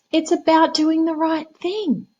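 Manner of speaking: high, slow smug cat voice; bossy cat. Acted. Cat scolding the frog, high slow prim voice.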